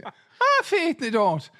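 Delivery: a quick mock Irish brogue, the Ah leaping high; Irish accent. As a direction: toss it off in a playful Irish accent. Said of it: "I think the Irish beg to differ".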